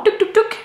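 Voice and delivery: high-pitched little "touc" clicking noises; imitated counter clicks. Vlogger shows how she counts knitting rows, high clicking imitation. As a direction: mimic quick clicks, pitched well up.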